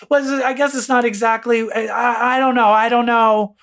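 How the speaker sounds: high, stammering mock backpedal; backtracking. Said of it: "And then backtracks".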